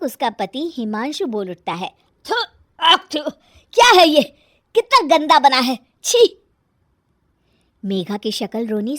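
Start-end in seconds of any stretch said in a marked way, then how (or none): 2.3-6.4 loud spitting revulsion; disgusted husband. Acted. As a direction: spit it out and complain, high and loud. Husband spits out bad food.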